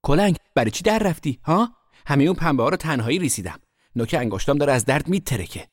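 high, loud indignant outburst; angry scolding. A narrator's reading. Sister confronting her runaway brother, angry scolding. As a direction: go at them high and loud, aggrieved.

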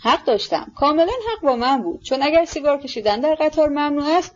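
mildly heated, triumphant self-justification; fervent, triumphant. A narrator's reading. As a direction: argue your case as the clear winner. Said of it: "با لحنی پرحرارت و پیروزمندانه گفت".

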